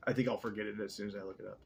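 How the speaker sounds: usual speaking voice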